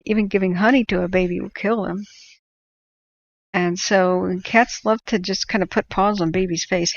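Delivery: normal talking voice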